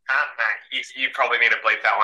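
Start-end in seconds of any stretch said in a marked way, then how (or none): 0.1-0.8 a broad Australian accent on mate; Australian accent demo. Shown as a demonstration. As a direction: finish the Aussie line casually. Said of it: "you've gotta be like".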